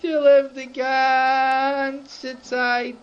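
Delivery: tearful storybook lament; mock weeping. A narrator's reading. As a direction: wail it slowly, stretching the first word. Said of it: "angeheben, weinen".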